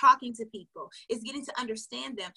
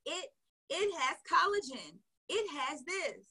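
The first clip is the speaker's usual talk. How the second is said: mock salesy pitch; 3 semitones higher, 7 dB louder, slower than usual. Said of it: "Would you text your friend and be like".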